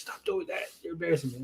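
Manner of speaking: mock mortified half-whisper; embarrassed hush. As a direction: plead under your breath, imitating someone embarrassed. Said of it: "he was all embarrassed".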